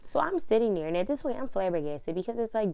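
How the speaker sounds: ordinary talk, nothing marked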